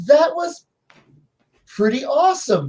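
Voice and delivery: high, awed mock praise; amazed praise. Players marvel at the sacrifice, high and awed. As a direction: gush like awestruck players.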